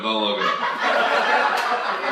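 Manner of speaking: drawn out slowly through a laugh; slow, amused warning; slow laughing plea. Real, not acted. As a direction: stretch it out, giggling.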